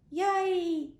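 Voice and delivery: loud high cheer; a big delighted cheer; cheering yay. Acted. Older brothers cheering at being chosen, loud and high. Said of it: "said the three eldest brothers".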